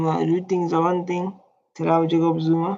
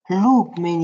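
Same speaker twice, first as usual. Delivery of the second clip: usual speaking voice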